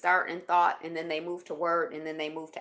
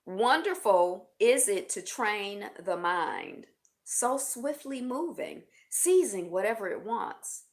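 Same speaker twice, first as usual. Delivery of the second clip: slow, measured reading with lifted phrases; quote reading; 4 semitones higher, 5 dB louder, slower than usual. Real, not acted. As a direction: read it out slowly, like daily wisdom. Reads Buddha quote aloud.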